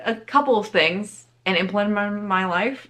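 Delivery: normal talking voice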